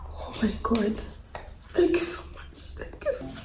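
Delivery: breathless whispered thanks; awed whisper. Real, not acted. Thanking her partner in an awed whisper. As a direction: whisper it, overcome with gratitude.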